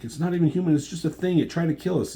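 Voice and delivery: high-pitched acted-out pleading; retold plea, pitched well up; urgent persuasion. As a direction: imitate someone reasoning urgently with a friend. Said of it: "They're trying to tell her to calm down".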